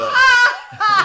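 high-pitched loud cackle of laughter; high laughing fit. Real, not acted. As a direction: burst out in helpless squealing laughter. Laughing at the Titanic parody. (carcajada aguda y fuerte)

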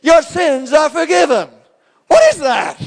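high, loud, incredulous shout; friends mocking in disbelief. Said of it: "They're going".

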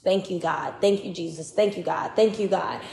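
low, soft repeated thanks; murmured prayer. Retold or imitated. Believer thanking God for an hour, murmured prayer.

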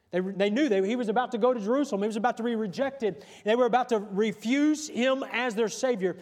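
full-voiced sermon delivery; projected, rolling pulpit cadence; preaching. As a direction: preach it out with driving emphasis.

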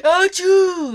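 loud high squeaky sneeze; shrill overdone sneeze; irritating fake sneeze. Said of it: "butchered the sneeze of being like".

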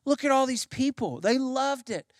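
a hint of an incredulous stagehand; puzzled stagehand. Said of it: "one of the stagehands says".

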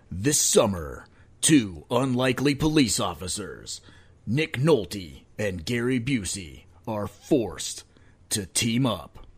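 loud, booming trailer announcer; movie trailer voice. Pretend. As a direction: sell it like a blockbuster trailer. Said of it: "Time for a trailer voice".

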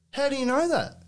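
high retold challenge; questioning young lad. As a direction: imitate a lad quizzing someone. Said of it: "this young lad goes".

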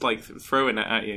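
ordinary delivery

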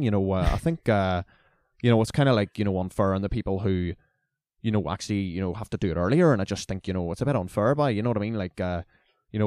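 put-on thick Fermanagh country accent. Country student protesting to the lecturer, in a mock thick Fermanagh accent. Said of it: "thickest, like, Fermanagh, Tyrone ... country accent".

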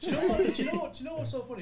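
high, loud, laughing burst; bursting to tell the joke; laughing excitedly. Real, not acted. Excited laughing story start.

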